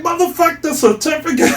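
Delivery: yelling off a pointless award; mock shout. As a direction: shout it down with a laugh. Mocking pointless certificate award.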